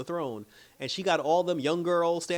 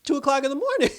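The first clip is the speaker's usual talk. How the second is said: high, louder, laughing through the words; laughing scold; 12 semitones higher, 6 dB louder than usual. Retold or imitated. Man scolding woman for walking at night, laughing. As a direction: scoff at her as you crack up.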